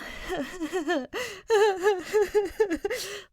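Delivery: a long high laugh that almost cracks. Real, not acted. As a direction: burst out laughing, half laughing, half crying. Laughing after finishing the chapter.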